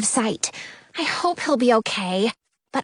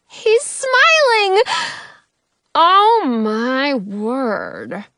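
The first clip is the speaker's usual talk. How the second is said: delighted king voice; 3 semitones higher, 3 dB louder, much slower than usual. A narrator's reading. King's delighted voice.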